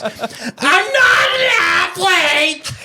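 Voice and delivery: very high, very slow, wailing mock sob; mock crying wife. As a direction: bawl it out like a hammed-up crybaby. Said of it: "That's what she says to me".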